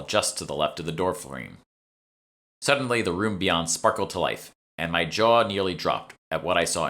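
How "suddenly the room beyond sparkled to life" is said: ordinary delivery, nothing marked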